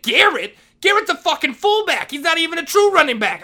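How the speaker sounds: scornful yell. Retold or imitated. Vice principal dismissing Garrett, scornful yell. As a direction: spit the name back in scorn. Retold shouting match with principal.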